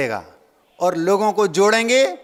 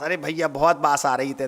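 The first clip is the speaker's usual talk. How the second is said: Bundelkhandi speech; 5 semitones lower, 3 dB quieter than usual. Retold or imitated. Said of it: "Bundelkhand में बास बोलते हैं".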